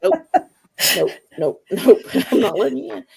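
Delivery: teasing no through laughter; laughing refusal. Retold or imitated. Locked in the truck, laughing while refusing to open the door. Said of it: "And I'm just like".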